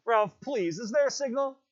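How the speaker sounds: high, pleading, urgent voice. Acted. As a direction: beg him for the answer. Piggy begging Ralph for answer.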